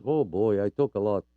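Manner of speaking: ordinary talk, nothing marked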